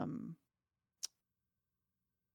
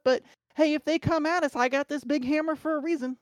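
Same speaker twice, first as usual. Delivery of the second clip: bad Southern accent; 7 semitones higher, 7 dB louder than usual. Shown as a demonstration. Player shows off Mama Millicent on request, bad Southern accent. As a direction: put on a hammy Southern accent, pitched well up. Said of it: "I would love to hear the bad Southern accent".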